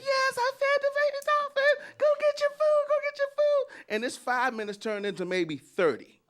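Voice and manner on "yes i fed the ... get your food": squeaky high dolphin voice; baby dolphin voice. Retold or imitated. Dad cooing to his potty-training daughter in a dolphin voice. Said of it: "talking like a baby dolphin".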